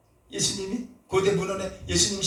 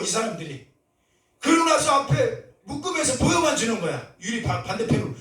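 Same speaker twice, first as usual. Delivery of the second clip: ordinary delivery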